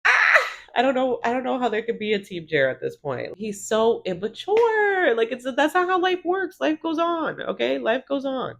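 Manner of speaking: high-pitched exasperated rant; groan, then vent in a high, incredulous voice. Real, not acted. Recapper fed up with immature Jer, exasperated.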